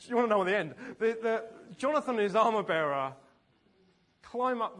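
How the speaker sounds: loud, driving preacher's voice; preaching at full volume, laughing through it; full-force preaching. Acted. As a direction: drive the story home to the crowd. Preaching at full force, story climax.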